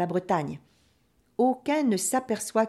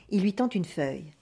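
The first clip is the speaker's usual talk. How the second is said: usual speaking voice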